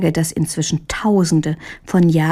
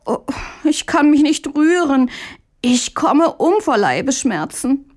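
fake-sick groaning; 6 semitones higher than usual. Lazy brother fakes sickness to his brother, pitiful high moaning. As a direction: groan and play it up like you are dying. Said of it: "Und Mündlein ächzte noch stärker".

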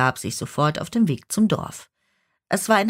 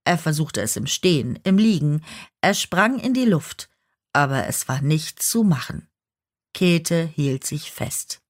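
plain reading voice

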